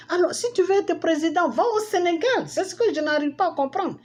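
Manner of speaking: high-pitched, heated rant; indignant telling-off. Real, not acted. Mock rant at absent politician.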